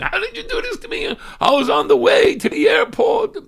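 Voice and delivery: high, loud screaming complaint; an upset traveler shouting at God. Retold or imitated. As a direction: yell it as if wronged by God. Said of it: "They can start screaming".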